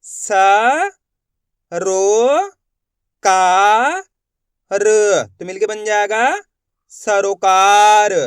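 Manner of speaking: "स, रो, का, र" called out one by one with pauses, then "सरोकार" joined; syllable-by-syllable reading. Shown as a demonstration. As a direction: sound out each piece, then blend it. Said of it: "देखो किस तरीके से". (हर अक्षर अलग बोलो, फिर जोड़ो)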